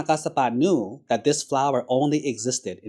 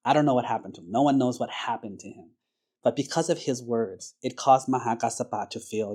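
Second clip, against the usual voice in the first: ordinary delivery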